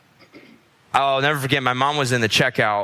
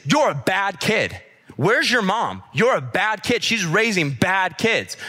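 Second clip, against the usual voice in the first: high, drawn-out mock scolding; retold yelling; 6 semitones higher, slower than usual. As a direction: imitate a stranger accusing, pitched up and stressed. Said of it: "she just started yelling at me".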